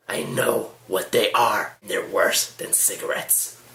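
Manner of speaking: slow, mock-monster old woman voice; beastly old lady. Old lady turned beast to vaper, slow mock-monster voice. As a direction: drag it out like a beast. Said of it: "the beast".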